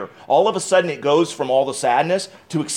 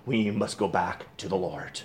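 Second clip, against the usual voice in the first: hushed appeal; 8 semitones lower, 12 dB quieter than usual. Acted. Preacher drops to hushed appeal.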